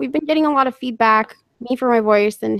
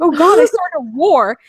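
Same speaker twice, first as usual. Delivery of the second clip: higher, louder retold alarm; mock dismay; 6 semitones higher, 5 dB louder than usual. Watching commenters fight over her, mock dismay. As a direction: play up the oh-no moment.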